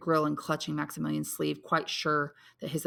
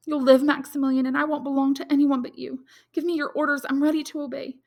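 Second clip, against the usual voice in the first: put-on higher, distressed young woman's voice; young woman Valentine; 9 semitones higher, 6 dB louder than usual. A narrator's reading. Valentine confides her bad news to Maximilian, anxious and higher.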